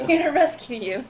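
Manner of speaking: Power Ranger. Acted. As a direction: proclaim it like a hammed-up rescuer. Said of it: "Do your Power Ranger shit".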